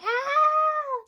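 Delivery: sudden high scream of labour pain. Retold or imitated. Retelling labour to viewers, a piercing scream. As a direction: let out a piercing shriek. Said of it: "like that".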